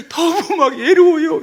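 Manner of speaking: high, hammed-up lonely plea; mock-pitiful flirtation; pleading lonely woman. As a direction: play a woman fishing for sympathy. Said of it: "이러면은요, 남자들 동정심을 유발해가지고".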